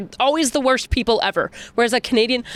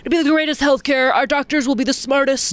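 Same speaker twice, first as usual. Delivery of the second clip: higher-pitched mock stump speech; Trump-style boasting; 6 semitones higher than usual. Host playing Trump promising healthcare, mock boasting. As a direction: sell it with put-on superlatives.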